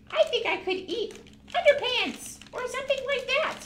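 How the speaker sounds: high, fast, playful goat puppet voice. Acted. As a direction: chatter like a greedy little goat.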